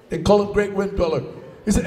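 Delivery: usual speaking voice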